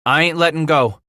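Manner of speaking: resolute. A narrator's reading. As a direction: stand your ground firmly. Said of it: "Bass said resolutely".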